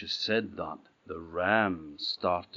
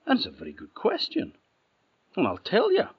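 excited; 13 semitones higher, 5 dB louder, faster than usual. A narrator's reading. Narrator teasing the listeners.

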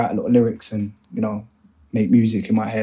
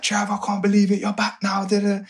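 excited greeting; 8 semitones higher than usual. Retold or imitated. Benny greets him after long absence.

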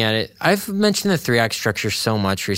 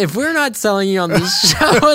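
high, louder, laugh bursting through; breaking into laughter; 8 semitones higher, 4 dB louder than usual. Real, not acted. Host pitching the show to listeners, cracking up.

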